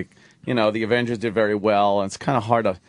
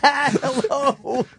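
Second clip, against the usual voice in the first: high, mock Russian hello; mock Russian accent. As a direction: echo the Russian girl's hello, higher. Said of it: "echoes Roland's Russian hello".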